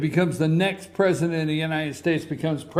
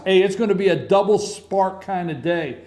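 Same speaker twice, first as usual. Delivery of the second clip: ordinary talk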